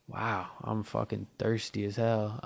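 ordinary talk

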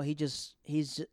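ordinary talk

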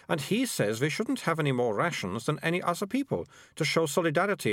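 put-on a bossy girl explaining things; prim girl. Melissa explains the town.

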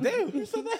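squealed high through a laugh; laughing disbelief. Retold or imitated. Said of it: "I'm like".

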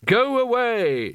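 high, slow jokey shout; mock shout. A narrator's reading. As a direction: bellow it as a joke. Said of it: "shouted the wizards".

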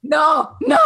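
loud, high mock wail of dismay; playful distressed no; half-joking cry. Real, not acted. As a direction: cry out no as if about to tear up. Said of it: "because I'm gonna cry".

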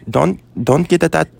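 mock Lola warning off the tattoo; grandma scolding, imitated half-laughing; imitating grandma. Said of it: "She's like".

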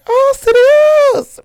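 very high, loud, crying-out squeal; shrieking impression. Retelling the funny live video, she squeals the rapper's line. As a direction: shriek it like the viral clip through laughter. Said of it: "We'll be like".

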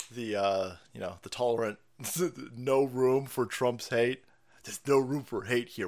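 ordinary talk, nothing marked

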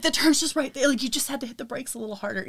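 exasperated retold gripe at the driver; the turn is right there, told in mock exasperation. Exasperated at boyfriend's driving.

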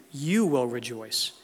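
"You" stretched out with a pitch swell, the rest falling away; slow down and lean on "You"; stress on YOU. Real, not acted. Preacher pointing the promise at his listeners, emphasis on "You". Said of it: "emphasis on the word "you".

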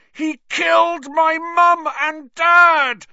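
slow, very high, full-voiced scream; drawn-out anguished shout; furious roar. A narrator's reading. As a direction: roar your grief and rage. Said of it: "Harry roared".

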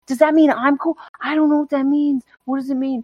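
high, worked-up self-questioning; hammed-up flustered wondering; mock overthinking. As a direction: spiral into giddy confusion. Acts out overthinking a compliment.

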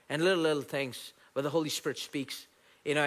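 usual speaking voice

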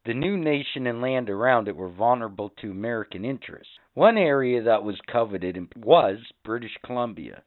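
lively history-show narrator voice; mock history-program read; documentary narration. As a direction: present it like an educational TV segment. Reads a history segment.

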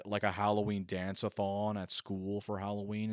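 normal talking voice

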